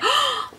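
high, quick intake of breath; delighted gasp. Real, not acted. Vlogger reacting to her new bag, high delighted gasp.

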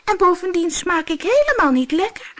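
tiny goat begging, high pitched; squeaking goat. Acted. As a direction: squeak it like a frightened kid goat. Little goat pleads with monster.

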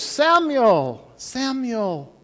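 high, drawn-out call in the night. Acted. Mysterious voice calls the sleeping boy in the night, calling out. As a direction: call the name out, falling away at the end. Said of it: "he hears this voice".

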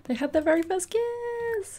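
high-pitched happy squeal; gushing delight. Real, not acted. Player celebrating the couple's first kiss, high delighted squeal.

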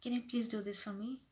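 soft, courteous request; humble, polite. Shown as a demonstration. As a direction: ask gently with a smile. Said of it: "just be little humble, little polite".

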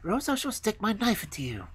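put-on high-pitched girlish threat; threatening robber girl.

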